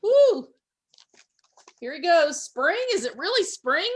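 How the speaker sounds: high, loud breathless questions; hopeful, eager disbelief; excited skunk. A narrator's reading. Skunk hearing of spring, excited.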